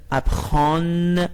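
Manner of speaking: "اپخان" with a clearly sounded, held final n; full N ending. Shown as a demonstration. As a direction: say "اپخان" slowly as spelt, open "aan" with a hard n. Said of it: "ولی حالت نزل هست".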